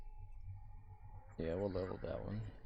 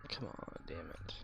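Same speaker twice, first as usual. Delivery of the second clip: breathy whisper, low and impatient; whispered frustration; 5 semitones lower, 14 dB quieter than usual. Real, not acted. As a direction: hiss it under your breath. Impatient waiting in game.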